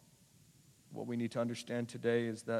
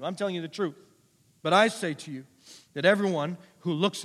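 loud, raised scripture proclamation; emphatic preaching; 4 semitones higher, 8 dB louder than usual. Acted. Preacher voicing Jesus to the congregation, emphatic preaching. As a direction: proclaim it with punched key words.

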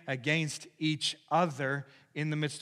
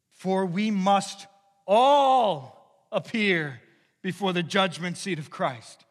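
slow, loud, higher emphatic proclamation; preaching at full force; 7 semitones higher, 12 dB louder, much slower than usual. Real, not acted. Preacher proclaiming the verse to the congregation, slow and loud. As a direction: pronounce it like a verdict.

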